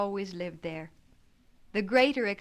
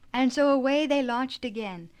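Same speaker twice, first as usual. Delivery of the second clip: ordinary delivery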